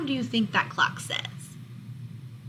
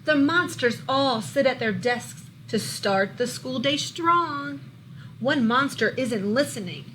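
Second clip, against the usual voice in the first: lively, louder storybook read-aloud; reading to children; 3 semitones higher, 5 dB louder than usual. A narrator's reading. Teacher reading a monster book to her class, lively storybook voice. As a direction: bring the picture book alive for the class.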